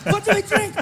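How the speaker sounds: German heckler. Retold or imitated. German heckler voiced again.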